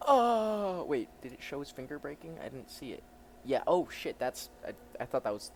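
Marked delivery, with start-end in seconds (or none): 0.1-0.8 high, loud drawn-out yell; hammed-up wail; acted pained cry. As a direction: cry out as if struck. Acted cry as finger breaks.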